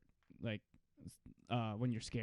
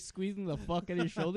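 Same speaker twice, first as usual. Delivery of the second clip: usual speaking voice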